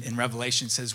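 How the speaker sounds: normal talking voice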